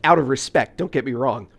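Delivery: ordinary talk, nothing marked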